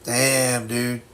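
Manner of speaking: normal talking voice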